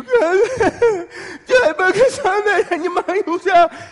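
high, loud mock-crying gibberish; hammed-up tantrum jabber; wailing baby babble. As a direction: babble tearfully like an upset toddler.

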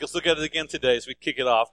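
ordinary delivery

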